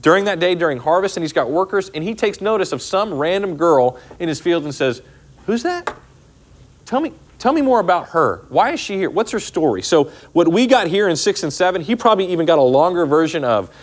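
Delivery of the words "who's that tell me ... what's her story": curious Boaz. Retold or imitated. Teacher voices Boaz asking about Ruth.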